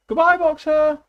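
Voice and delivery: high, loud cheerful call; chorused farewell. A narrator's reading. The animals waving off Boxer's van, loud cheerful farewell. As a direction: call out goodbye together. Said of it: "they chorused".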